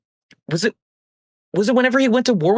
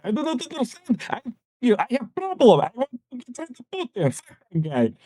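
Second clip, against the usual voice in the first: higher-pitched, hammed-up Ukrainian accent; mock Ukrainian accent; 4 semitones higher, 5 dB quieter than usual. Zelensky protesting about Putin, mock Ukrainian accent. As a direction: imitate a pleading Ukrainian accent. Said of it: "in his Ukrainian accent".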